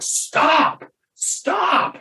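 very high-pitched mock yell, no real volume; imitated alarmed cry. Imitates others halting the game.

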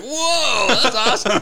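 high delighted whoa; amazed at the car wash; surprised. Retold or imitated. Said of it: "And I was like".

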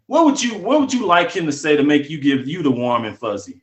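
usual speaking voice

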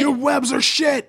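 high slow shouted insult; mock shouting. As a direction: yell it, hammy and slow. Joins mock yelling at spider.

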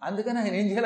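ordinary talk, nothing marked

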